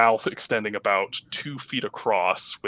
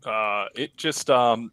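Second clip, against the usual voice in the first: usual speaking voice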